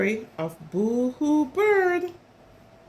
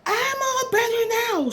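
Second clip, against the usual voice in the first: much higher, raised-voice call; storybook shout; 11 semitones higher, 4 dB louder than usual. A narrator's reading. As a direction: shout it as the little bird trying to be heard. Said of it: "shouted Bird".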